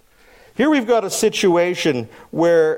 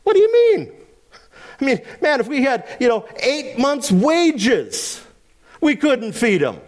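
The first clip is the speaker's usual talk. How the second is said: indignant "what do you mean?"; a little miffed; 7 semitones higher than usual. Retold or imitated. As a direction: apostles answering Jesus, a little miffed. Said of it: "Their response is a little miffed".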